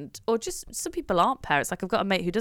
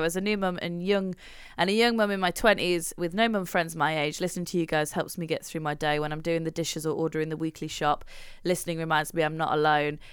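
reading a review aloud; 4 semitones lower than usual. Acted. Host reading a fan review to co-host, gentle read. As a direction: read the listener's message aloud.

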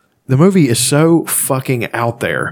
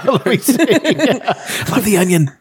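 slow, laughing through words; 12 semitones higher, slower than usual. Real, not acted. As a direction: say it while cracking up. Laughing at headline.

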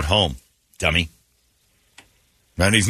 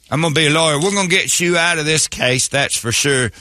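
higher, hammed-up Southern lawyer; country lawyer accent; 5 semitones higher than usual. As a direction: reassure a client in a folksy Southern accent.

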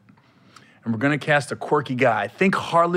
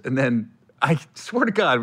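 normal talking voice

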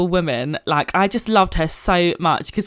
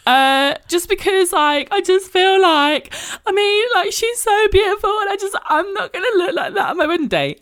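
tearful whimper; 13 semitones higher than usual. Retold or imitated. Bride in the fitting room tells seamstress, teary high whimper. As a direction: imitate herself crying in the cubicle. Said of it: "just absolutely crying".

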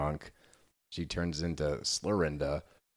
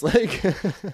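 laughing; 13 semitones higher, 9 dB louder than usual. Real, not acted. Laughing at the scene.

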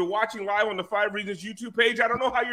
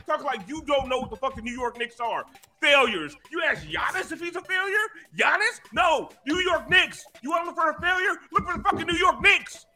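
mocking rant; 7 semitones higher than usual. Real, not acted. Mocking rival fans.

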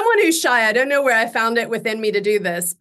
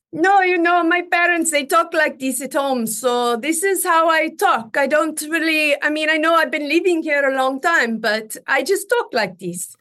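higher-pitched put-on Russian accent; 4 semitones higher than usual. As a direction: pass off a Russian accent as your real one. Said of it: "only speaking the accent, saying this was my real accent".

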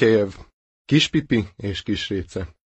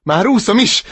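high, loud happy shout; 10 semitones higher, 6 dB louder than usual. Acted. Duckling calling out while swimming, shout. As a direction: call out from the water. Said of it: "kiáltotta".

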